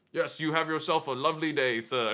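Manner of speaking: lightly imitated Renaissance fair gatekeeper; Renaissance accent. Gatekeeper sends visitors on their way, in a light imitation of his Renaissance accent. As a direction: put on a hint of faire-worker accent. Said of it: "he had his accent and everything".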